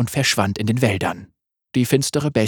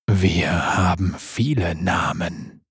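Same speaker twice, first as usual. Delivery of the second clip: langsam, tief und lauernd; tiefe Wolfsstimme; 5 semitones lower, slower than usual. Acted. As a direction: ein Raubtier, das leise droht. Wolf speaks, low voice. (Wolf spricht tief und langsam)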